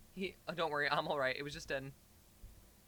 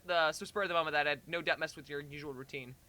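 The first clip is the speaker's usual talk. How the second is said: plain reading voice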